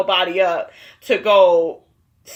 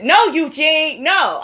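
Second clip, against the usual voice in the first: emphatic imitated objection, stretched and higher; mock Toya protest; 6 semitones higher, 3 dB louder, slower than usual. Recapper mimicking wife cutting off her husband, mock protest. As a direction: act out an insistent no. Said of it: "Here go Toya".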